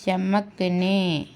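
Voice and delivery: चमकने stretched very slowly, syllable by syllable; slow demo. Shown as a demonstration. Teacher spelling चमकने aloud very slowly for the class. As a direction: draw out चमकने so students can follow. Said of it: "इस तरीके से".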